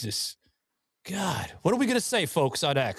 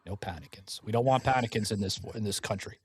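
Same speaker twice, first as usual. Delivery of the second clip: softer, lower hush; 7 semitones lower, 5 dB quieter than usual. Pretend. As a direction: say it low like a lullaby. Hushed repeat of the catchphrase.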